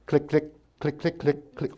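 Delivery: soft clicking sounds of a dead ignition; quiet mock ignition clicks; imitated starter clicks. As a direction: mimic the key turning on a dead battery.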